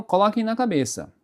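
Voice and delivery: ordinary talk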